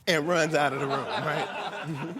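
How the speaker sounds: normal talking voice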